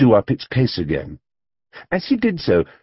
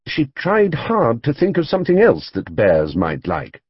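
ordinary delivery, nothing marked

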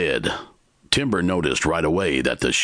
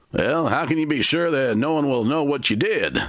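Timber asking; 11 semitones higher than usual. A narrator's reading. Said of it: "Timber asked".

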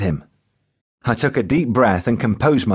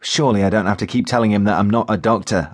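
ordinary delivery, nothing marked

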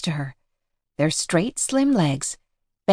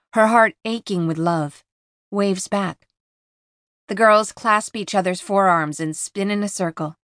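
ordinary delivery

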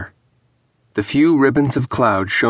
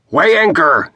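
louder, higher bellowed order; shouting; 7 semitones higher, 5 dB louder than usual. A narrator's reading. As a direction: bellow it across the deck. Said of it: "shouted Perth".

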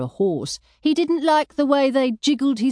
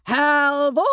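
mild narrated yell; 6 semitones higher than usual. A narrator's reading. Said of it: "she yelled".

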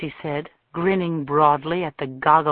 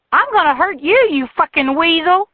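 shrill, much higher furious shout; furious woman; 12 semitones higher, 7 dB louder than usual. A narrator's reading. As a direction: hurl it at him, loud and high. Jilted woman attacks Tucker.

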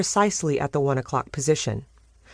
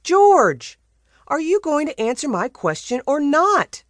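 demanding; 11 semitones higher, 4 dB louder than usual. Acted. Niece demanding an answer from her uncle, loud. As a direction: call his name out loud and high, then press the question. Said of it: "Sam demanded".

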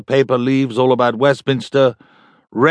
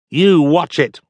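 threatening; 4 semitones higher, 4 dB louder, slower than usual. Acted. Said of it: "A certain lowness was coming out now".

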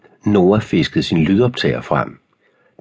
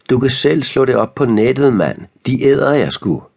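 jeering taunt; 6 semitones higher than usual. A narrator's reading. Bully shouts after fleeing boy.

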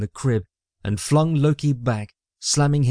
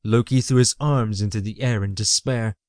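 plain reading voice

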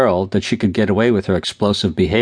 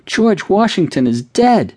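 wailing proclamation; 11 semitones higher, slower than usual. A narrator's reading. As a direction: proclaim the tragedy through tears. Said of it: "proclaimed Cindy".

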